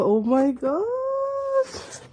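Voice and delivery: slow, soaring high squeal; excited squeal. Real, not acted. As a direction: draw it out into a long, high squeal. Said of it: "I'm so excited".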